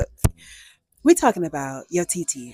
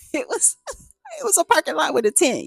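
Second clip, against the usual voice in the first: laughing disbelief; 10 semitones higher than usual. Real, not acted. Laughing at the shabby lot.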